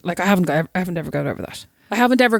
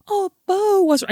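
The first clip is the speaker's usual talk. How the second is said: much higher sweet teacher voice; concerned teacher; 11 semitones higher, slower than usual. Retold or imitated. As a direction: coo at the child. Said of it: "his teacher comes down and goes".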